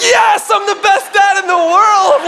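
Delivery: high, slow, drawn-out triumphant yell; exultant shout. Acted. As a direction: crow it loudly like a champion dad. Father's exultant inner cheer at son's question.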